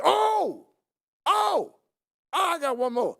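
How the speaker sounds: high, bursting oh of remembering; sudden realization. Real, not acted. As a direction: it just hit you, blurt it out high.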